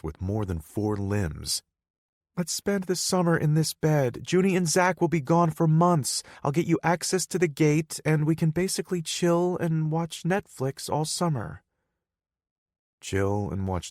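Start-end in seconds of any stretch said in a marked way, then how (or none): 2.4-8.0 put-on higher, playful girlfriend voice; girlfriend Hannah. Hannah coaxing her lover to stay, higher playful voice. 8.0-11.6 put-on higher, teasing girlfriend voice; girlfriend Hannah. Hannah tempting her lover to stay, higher teasing voice.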